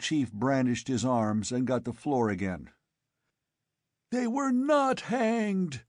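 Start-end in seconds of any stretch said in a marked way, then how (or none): 4.1-5.8 high, slow shrill screech. A narrator's reading. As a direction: screech it at him. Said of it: "he screeched".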